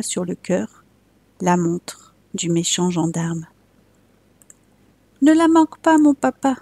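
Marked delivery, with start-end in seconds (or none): 5.2-6.6 put-on high little boy's voice calling out; brave small child. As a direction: call to papa like a trusting little boy. Child Jean speaks to his father.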